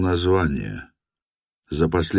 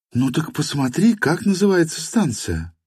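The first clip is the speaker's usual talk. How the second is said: higher woman's voice, impatient; young woman voice; 11 semitones higher than usual. A narrator's reading. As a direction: urge him, pitched up. (женский голос, нетерпеливо)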